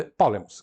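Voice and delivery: "polemos" with a strong first syllable; accented Greek word. Shown as a demonstration. Teacher showing Greek accent, "polemos" stressed early. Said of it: "tem acento na penúltima".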